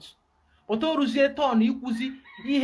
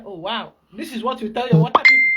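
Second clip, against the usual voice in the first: swelling to a loud incredulous exclamation; mock astonishment; 11 dB louder than usual. Pastor retelling his reply to the client, mock astonished and loud. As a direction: exclaim at the silly advice, louder and louder. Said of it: "I say".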